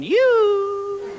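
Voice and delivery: very high, drawn-out "yoo" hoot; high-pitched call. Acted. Comic calling the faraway polar bear, high long hoot. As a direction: hoot to someone miles away.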